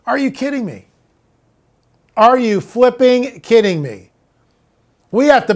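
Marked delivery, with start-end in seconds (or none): 0.1-0.9 higher, stronger disbelief, still soft; higher incredulous repeat; rising disbelief. Acted. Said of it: "repeated with growing emphasis". 2.2-4.1 slow, exasperated disbelief; slow fed-up outburst. Acted. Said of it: "escalating repeat".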